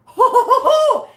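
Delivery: high, loud, sudden laugh that breaks out; loud burst of laughter. Real, not acted. Vlogger laughing at a commenter's snarky reply, loud burst of laughter. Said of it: "So I'm laughing now".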